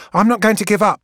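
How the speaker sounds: refuse, chin up, to abandon the task; defiant. Acted. Gang girl refusing to quit in front of Keeler, defiant and loud.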